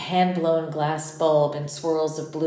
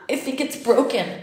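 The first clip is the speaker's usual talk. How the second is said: choked out; 7 semitones higher than usual. Acted. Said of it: "I choked out".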